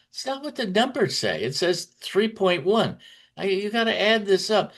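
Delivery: imitating a boss correcting an underling's sum; imitating Trump. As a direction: lecture him like he can't add. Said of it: "He says".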